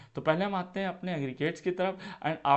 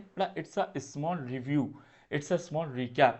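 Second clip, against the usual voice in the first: slow emphasis; much slower than usual. Shown as a demonstration. Teacher slowly stresses recap.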